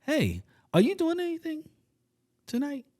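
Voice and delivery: put-on high, coaxing girlish ask; sweet girl voice. Woman asking the guy for his car, high and coaxing. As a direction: play her sweetly asking a favour. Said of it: "she says".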